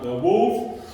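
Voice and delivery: plain reading voice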